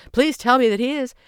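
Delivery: high, loud and anxious; desperate to find him; frantic question. A narrator's reading. Stressed young man asking the group, frantic. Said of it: "he asked frantically".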